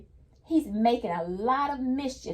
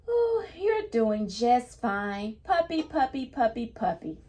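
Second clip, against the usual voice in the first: storybook reading voice; 2 semitones higher than usual. Acted. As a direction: praise the puppy like a child would. Teacher reads book's praising line.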